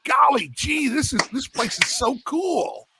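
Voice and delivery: high, slow gee-whiz awe; wide-eyed innocent guy. Retold or imitated. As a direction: play a naive awed recruit. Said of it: "He's like".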